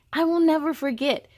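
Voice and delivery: usual speaking voice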